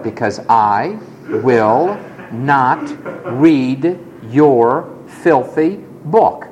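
each word spelled out slowly; slow, deliberate teenage defiance; slow defiant refusal. Retold or imitated. Defiant reply to teacher.

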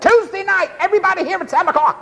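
high, rapid-fire marching orders; whip-cracking preacher. Acted. Preacher mimicking pushy demands on his congregation, high and hectoring. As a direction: rattle it off high like a driving preacher. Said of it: "And I'm a cracking the whip".

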